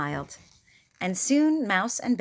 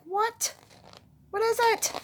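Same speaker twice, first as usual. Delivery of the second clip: much higher startled question; groggy surprise. A narrator's reading. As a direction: jolt awake confused. Mouse woken suddenly.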